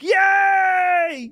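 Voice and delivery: high, shouted cheer; gleeful yay. Acted. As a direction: squeal with delight. Celebrating juicy gossip.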